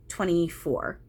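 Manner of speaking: ordinary talk, nothing marked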